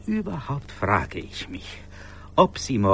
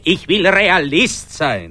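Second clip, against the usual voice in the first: emphatic declaration; 5 semitones higher, 9 dB louder, slower than usual. Acted.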